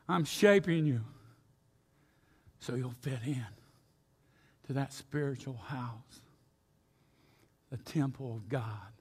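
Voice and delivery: soft, low reassurance; God's gentle answer. Retold or imitated. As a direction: reassure the stone quietly. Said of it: "But God says".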